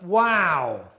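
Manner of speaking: excited. Real, not acted. Drone lands back in hand.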